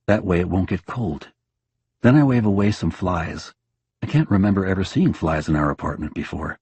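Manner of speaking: ordinary delivery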